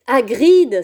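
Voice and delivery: loud called-out name; boy stopping his friend's tears; exclaiming. A narrator's reading. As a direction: cut him off with his name. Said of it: "s'exclama Harry".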